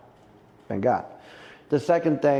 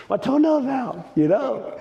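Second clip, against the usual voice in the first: mock whining, pitched high; 12 semitones higher than usual. Mocking himself as a crybaby over a broken toenail, whiny and high. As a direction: whine like a baby over a toenail.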